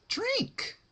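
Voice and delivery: high, sing-song sweet menace; bright cheery threat, voiced high; Pinkie Pie voice. Narrator gives Pinkie a high sweet creepy voice.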